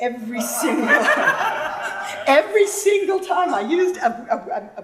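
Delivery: words broken up by real laughter; laughing. Real, not acted. To the audience about her old professor, laughing while telling. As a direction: laugh through the memory of his red pen. Recalling professor's margin notes fondly.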